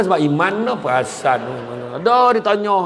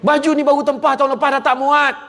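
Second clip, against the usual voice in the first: high, loud whiny complaint; complaining wife; 11 semitones higher, 4 dB louder than usual. Acted. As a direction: whine about the shrunken dress. Wife's complaint voiced. (isteri merungut, suara tinggi)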